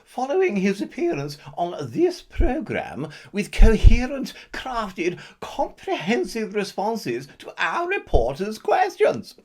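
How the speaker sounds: punchy newsreader delivery; mock news read. Anchor reporting the mayor's approval ratings, in a mock newsreader voice.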